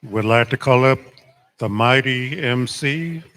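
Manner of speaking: powwow emcee. Real, not acted. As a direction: hype up the guest like an announcer. Hyping up the next emcee.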